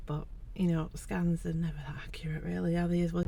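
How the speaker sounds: soft whispered aside; whispering. Real, not acted. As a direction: whisper it to yourself. Unvoiced aside about scans.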